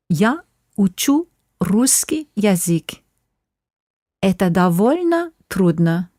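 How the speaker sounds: "Я учу русский язык" said slowly, syllable by syllable; slow it down so learners catch every word; slow demo. Shown as a demonstration. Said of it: "I'll say this slowly".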